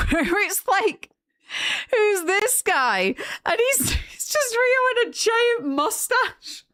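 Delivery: high, slow words through laughter; laughing. Real, not acted. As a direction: giggle through the story of the ad. Laughing while retelling ad.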